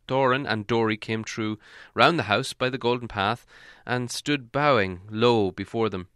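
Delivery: plain reading voice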